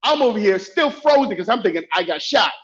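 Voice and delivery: normal talking voice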